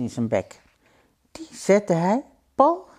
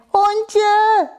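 high-pitched, desperate shout; calling out; 7 dB louder than usual. Acted. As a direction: cry out loudly for your friend. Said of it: "riep het konijn". (hoog en luid uitroepend)

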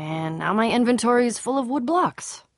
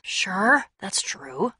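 usual speaking voice

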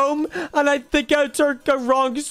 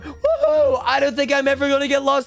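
excited; 3 semitones higher than usual. Acted. Lost child finally finds home.

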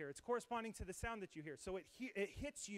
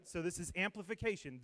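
ordinary talk

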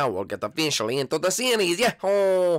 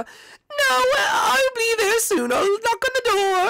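mock crying shout. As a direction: wail it like a hammed-up sobbing mother. Mom insists on coming over.

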